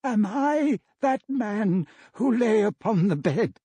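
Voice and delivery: Scrooge's despairing question to the ghost; anguished cry. Acted. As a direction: cry out on your knees in dread. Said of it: "he cried upon his knees".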